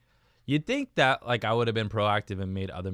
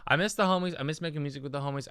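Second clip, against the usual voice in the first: mean it this time, from the heart; sincere; 6 semitones higher, faster than usual. Shown as a demonstration. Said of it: "dude, I, I really do, though".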